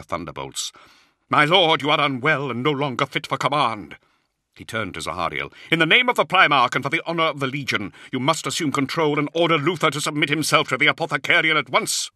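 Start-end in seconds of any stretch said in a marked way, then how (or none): 1.3-4.0 high, loud, formal denunciation; outraged challenge. A narrator's reading. Librarian relieving his lord of command, outraged. Israfel confronts Luther after roaring. 5.7-12.1 fast, high, loud formal appeal; urgent demand. A narrator's reading. Librarian urging Zahariel to take command, urgent and insistent.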